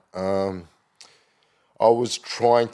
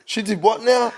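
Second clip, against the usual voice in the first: high, loud mock double take; mock disbelief; 14 semitones higher, 6 dB louder than usual. Listeners hearing the song, mock disbelief. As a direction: act shocked at what you just heard. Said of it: "And everyone's like".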